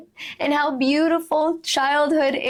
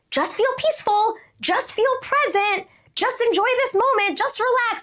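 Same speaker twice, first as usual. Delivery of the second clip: much higher, pushy pep talk; mock urging; 11 semitones higher than usual. People forcing themselves to feel calm, mock urging. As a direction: imitate someone insisting on forced calm, high-pitched. Mimicking what we order ourselves to feel.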